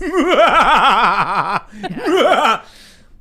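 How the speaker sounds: loud, high Doctor Evil cackle; evil villain laugh. Retold or imitated. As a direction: throw back your head and cackle. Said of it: "That's a really good evil villain laugh".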